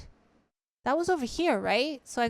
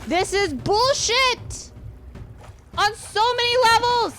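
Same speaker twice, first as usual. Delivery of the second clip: frustrated outburst; 6 semitones higher than usual. Real, not acted. Raging at the game.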